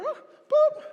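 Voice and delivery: high short beep; high imitated beep; beep sound. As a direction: mimic a machine button beep. Treadmill button beep.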